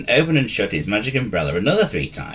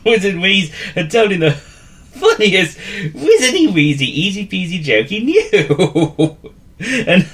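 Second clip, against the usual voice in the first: narration through real laughter, higher, louder; laughing while reading; 11 semitones higher, 6 dB louder, slower than usual. A narrator's reading. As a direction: read it cracking up.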